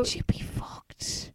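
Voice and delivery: breathy, slow whispered aside. Real, not acted. Host whispers a cheeky aside to her co-host. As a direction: whisper it like a shocked confidant. Joking aside about plane sex.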